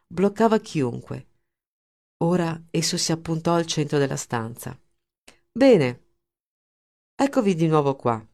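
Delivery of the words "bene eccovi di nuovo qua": dry, sarcastic welcome. A narrator's reading. Linder greets the managers who failed him, sarcastic. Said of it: "La sua voce trasudava sarcasmo".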